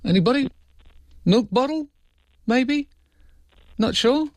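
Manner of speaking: high, tentative questions; puzzled high-voiced appeal for ideas; questioning. Real, not acted. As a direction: throw out one guess at a time, pitched right up. Fishing for guesses about a noise.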